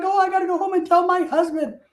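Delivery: imitating an excited nurse, high voice. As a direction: gush it in a high put-on voice. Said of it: "she's all excited".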